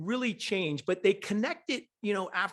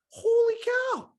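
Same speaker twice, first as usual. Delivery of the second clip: much higher and louder, amazed exclamation; stunned holy cow; 4 dB louder, slower than usual. Retold or imitated. Retelling his reaction to her success story, a high loud amazed exclamation. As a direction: let the amazement burst out.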